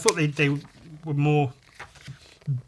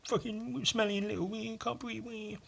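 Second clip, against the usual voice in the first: high, soft whiny mockery; mocking himself; 7 semitones higher, 4 dB quieter than usual. Mocking his own wheezing, high whiny voice. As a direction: whimper it like a crybaby, higher. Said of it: "Me making fun of myself, going".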